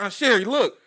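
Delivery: loud, high proud call to his aunt; a kid yelling to his aunt by the pool, proud and loud; excited kid call. Retold or imitated. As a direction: shout it out like a proud little boy.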